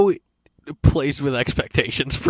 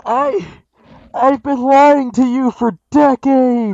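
loud, high and slow; to someone hard of hearing; 11 semitones higher, 9 dB louder, slower than usual. Pretend. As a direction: project every word slowly. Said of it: "Could you speak up, please?".

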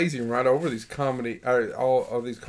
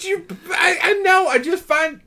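exasperated; 4 dB louder than usual. Real, not acted. Exasperated at skipped shows.

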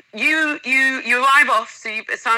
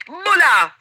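higher, bright shout of welcome, imitated; imitating a Bula greeting; 7 semitones higher, 3 dB louder than usual. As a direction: call out the greeting like a ukulele band. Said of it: "they go".

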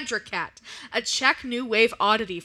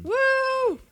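cheering; 11 semitones higher, 3 dB louder than usual. Real, not acted. Cheering at her introduction.

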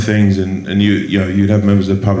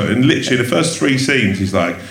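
normal talking voice

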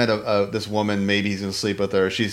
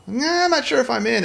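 higher-pitched, noncommittal mock waffle; hemming and hawing; 12 semitones higher than usual. Host teasing co-host about wavering, mock hemming and hawing. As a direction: imitate someone drawing out their doubts. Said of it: "you hem and haw".

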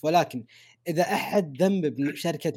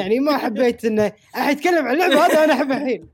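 pitched higher, laughing while backpedalling; laughing defensively; 9 semitones higher, 6 dB louder, faster than usual. Real, not acted. Defending himself to his co-hosts, higher and laughing. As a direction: chuckle through the excuse.

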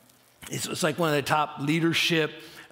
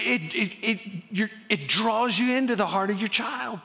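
ordinary delivery

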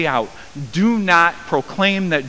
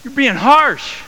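indignant protest; 10 semitones higher, 5 dB louder, slower than usual. Retold or imitated. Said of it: "we're like".

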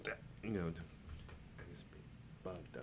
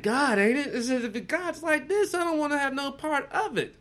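high-pitched, louder, slowing down on the first words; mock high voice; 14 semitones higher, 6 dB louder than usual. Friend recalls the voice from the acid trip, high and mock. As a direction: squeak it out like a cartoon voice. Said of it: "It's like".

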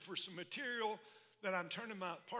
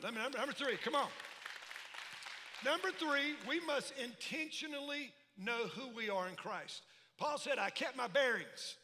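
preaching; 3 semitones higher, 4 dB louder than usual. Acted. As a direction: hammer the point home. Preaching next point.

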